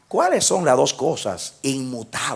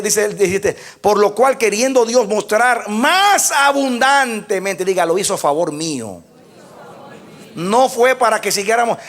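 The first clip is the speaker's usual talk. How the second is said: full-force preaching; 9 semitones higher, 6 dB louder than usual. Acted. Preacher proclaiming the verse to the congregation, full force. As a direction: proclaim the oath to the church.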